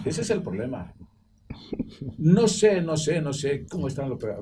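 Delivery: ordinary talk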